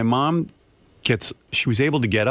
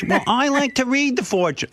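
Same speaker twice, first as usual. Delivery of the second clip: much higher, light mock mother voice; imitating mother; 13 semitones higher than usual. Mother defending her fortune reading, much higher. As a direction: sweetly insist like mom. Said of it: "my mother goes".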